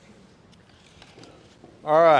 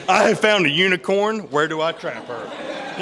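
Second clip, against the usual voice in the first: high, loud, laughing excitement; like you just struck gold; excited laughing quote; 5 semitones higher, 9 dB louder than usual. Retold or imitated. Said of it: "like, like".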